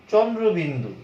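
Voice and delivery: চন্দ্রবিন্দু as "chondrobindu", firmly sounded out; emphatic, careful চন্দ্রবিন্দু; full letter-name pronunciation. Shown as a demonstration. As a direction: hit the start of চন্দ্রবিন্দু, then fall away. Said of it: "এই ধরনের কোনো কিন্তু".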